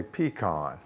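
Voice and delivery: "pecan" as PEE-kahn; variant pronunciation. Shown as a demonstration. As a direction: say "pecan" with a long pee, then kahn with an open ah. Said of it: "some people say".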